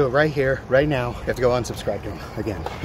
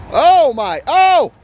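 loud, high cry of shock; alarmed shout; 14 semitones higher, 9 dB louder, much slower than usual. Real, not acted. Watcher reacting to a skater's trick, alarmed shout.

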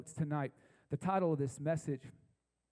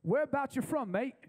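higher, louder mock British voice; British accent; 10 semitones higher, 4 dB louder than usual. British stranger questioning a faker, in an imitated British accent. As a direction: call it out in a put-on British accent. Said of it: "he turns and looks at me".

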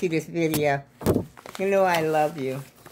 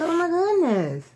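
surprised oh my goodness; 11 semitones higher than usual. Retold or imitated. Grandma retelling finding the bag on the porch, delighted surprise. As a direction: exclaim it like finding a hidden gift. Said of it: "I said".

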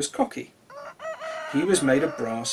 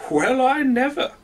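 loud, high exclamation of surprise; the sun catches the rooster out, well I never; surprised sun; 9 semitones higher, 5 dB louder than usual. Acted. Sun surprised at the rooster, loud and high. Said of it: "said the sun".

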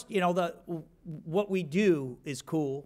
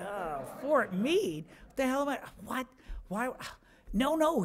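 mock bewilderment; 9 semitones higher than usual. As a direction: sound baffled and thrown. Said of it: "I go".

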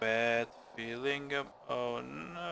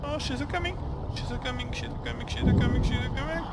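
singsong warning; 10 semitones higher, 4 dB louder, much faster than usual. Pretend. Singsong warning of approaching enemy.

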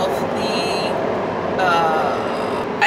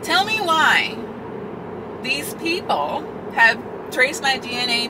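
incredulous; 5 semitones higher than usual. Real, not acted. Shocked by ancestry result.

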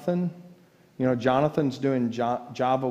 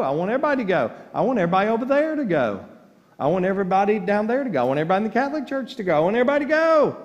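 building from loud to shouting, ever higher and faster; preaching crescendo; 8 semitones higher, 6 dB louder, much faster than usual. Acted. Urging the whole congregation to heaven, building preaching. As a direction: preach it up to full force.

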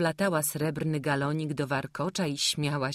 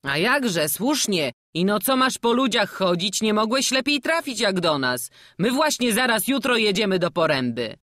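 louder, genial travelling-player voice; a jovial old jester coaxing the boy; hearty old showman; 6 semitones higher, 6 dB louder than usual. Acted. (dziarski stary kuglarz, głośniej)

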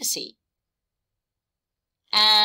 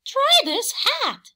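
very high, loud offering hen; Hen's voice; 5 dB louder than usual. A narrator's reading. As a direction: push the hat on Horse as Hen. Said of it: "Hen told Horse".

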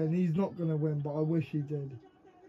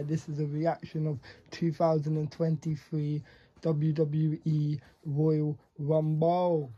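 usual speaking voice

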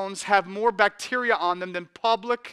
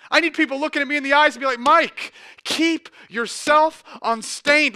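louder, higher preaching, slowing on the charge; full-force preaching; 4 semitones higher, 7 dB louder than usual. Real, not acted. Pastor urging the church to stay unstained, full force. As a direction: build to a passionate exhortation.

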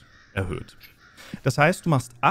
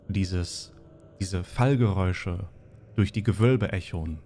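low, hushed dungeon narration; eerie scene narration; 3 semitones lower than usual. Acted. Game master describing the undead approaching, hushed and eerie.

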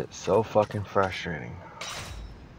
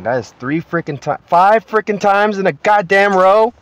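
real angry shout, rising high and loud; furious rant; 11 semitones higher, 10 dB louder than usual. Real, not acted. Streamer raging at the race he keeps losing, angry shout. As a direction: yell at the game for failing again.

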